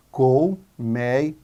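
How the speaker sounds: "Colmeia" slow and low, split col, mei, a; separated into syllables. Shown as a demonstration. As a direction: draw out each syllable of "Colmeia". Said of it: "Vamos separar em sílabas". ("Colmeia" devagar, sílaba por sílaba)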